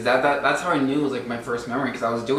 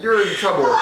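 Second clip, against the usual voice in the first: higher, louder imitated telling-off; mock scolding dad; 5 semitones higher, 6 dB louder than usual. Dad telling off a child, mock scolding.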